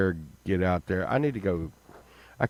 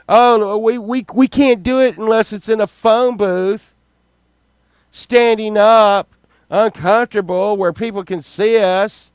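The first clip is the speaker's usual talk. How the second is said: high, loud sarcastic tirade; mocking rant; 11 semitones higher, 8 dB louder than usual. Real, not acted. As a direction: ridicule the idea at full volume.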